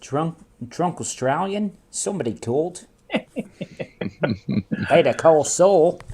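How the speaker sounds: imitating a drunk Australian mate; drunk Australian accent. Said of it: "Mark says".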